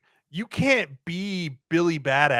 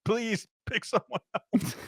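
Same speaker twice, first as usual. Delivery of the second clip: pleading dad; 9 semitones higher than usual. Retold or imitated. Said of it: "I'm like".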